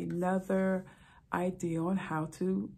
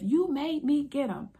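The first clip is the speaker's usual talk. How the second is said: arguing husband; 8 semitones higher, 4 dB louder than usual. Retold or imitated.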